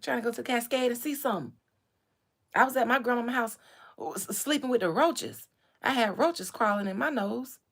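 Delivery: loud, fast hyped A-Town voice; fast Atlanta rapper impression. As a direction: rattle it off like King. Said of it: "is what King said".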